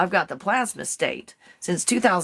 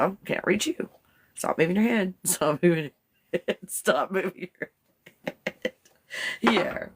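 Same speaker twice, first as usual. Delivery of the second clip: ordinary delivery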